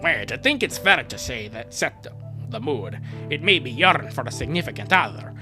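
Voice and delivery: normal talking voice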